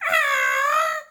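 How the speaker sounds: high loud startled puppet squeak; puppet squeal. Acted. Libby squealing as she is sat down, high yelp.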